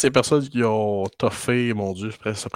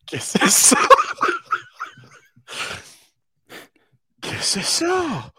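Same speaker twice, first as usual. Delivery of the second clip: amazed; 3 dB louder than usual. Real, not acted. Amazed at the art.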